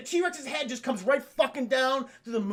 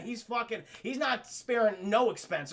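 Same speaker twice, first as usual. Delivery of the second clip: normal talking voice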